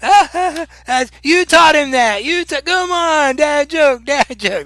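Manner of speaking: high, loud cackling and jeering; crowd's mock uproar. Game master voices everybody reacting to the dad joke, hammed-up uproar. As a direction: ham up a whole room egging on the joker. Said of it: "And then everybody's like".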